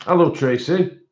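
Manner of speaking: ordinary talk, nothing marked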